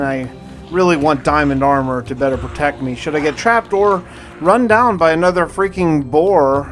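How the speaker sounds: ordinary talk, nothing marked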